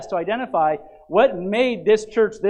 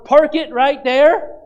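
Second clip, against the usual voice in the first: high, loud, slow drawn-out call; 6 semitones higher, 8 dB louder, slower than usual. Retold or imitated. Churchgoer urging the preacher on, high slow shout. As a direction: stretch it out like a churchgoer cheering.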